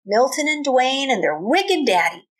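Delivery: lively read-aloud with a country twang; storybook reading. A narrator's reading. Teacher reading a cowboy tale to her class, lively twang.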